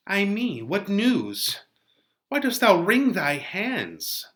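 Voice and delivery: lightly voiced Juliet, eager for news; Juliet read aloud. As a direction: ask the nurse for news, hopeful. Teacher reads Juliet's lines aloud.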